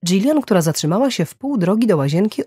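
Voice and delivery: usual speaking voice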